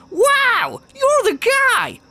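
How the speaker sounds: high-pitched squealing mock gush; mocking gushing fan. Mocking a gushing viewer who praised him, in a high squeal. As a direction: gush over him like a starstruck fanboy, way up high. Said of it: "he was just on here like".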